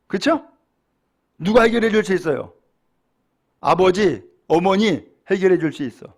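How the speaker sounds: normal talking voice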